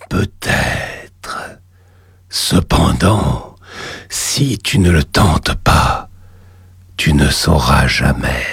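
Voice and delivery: deep, slow demon lord voice; low and slow, a voice from the depths; cavernous voice. Acted. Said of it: "une voix caverneuse".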